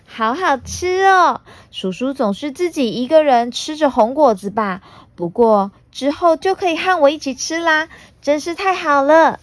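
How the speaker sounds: put-on high, delighted child voice; happy little girl. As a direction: gush happily like a little girl. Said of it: "普娜开心地说".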